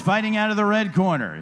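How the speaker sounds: ordinary talk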